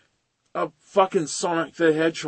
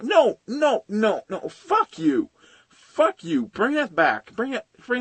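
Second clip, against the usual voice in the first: much higher, heated refusal; angry rant; 8 semitones higher, slower than usual. Real, not acted. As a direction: vent the frustration, pitch climbing. Ranting at cancelled shows.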